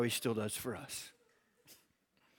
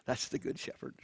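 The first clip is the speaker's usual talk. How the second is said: whispered with a laugh; soft breathy aside; 7 dB quieter than usual. Real, not acted. Pastor assures wandering listeners, a whispered tender aside. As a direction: let it fall to a near whisper, tender. Closing thought after a laugh.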